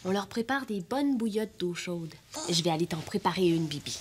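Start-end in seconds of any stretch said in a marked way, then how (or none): 3.7-4.0 whispered. Acted. Softly to sick Bibi.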